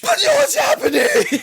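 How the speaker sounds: very high, loud, drawn-out shriek; mock outraged scream. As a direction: scream it in hammed-up triumph. Triumphant payoff, table flip.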